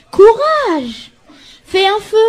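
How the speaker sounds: high, bright call of encouragement; cheery courage. Acted. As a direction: cheer her on from the studio. Anchor cheers the frozen reporter.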